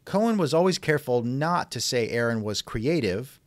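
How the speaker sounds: usual speaking voice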